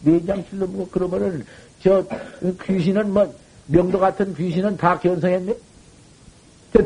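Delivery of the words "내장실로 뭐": usual speaking voice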